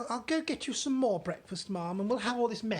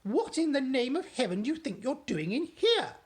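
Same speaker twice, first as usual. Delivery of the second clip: loud, high outraged demand; shouting; 10 semitones higher, 7 dB louder than usual. Acted. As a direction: shout at the intruder. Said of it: "she shouted at Sophie".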